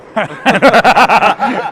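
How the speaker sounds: loud, high real laugh breaking out; burst of laughter. Real, not acted. Cracking up at own Dutch accent.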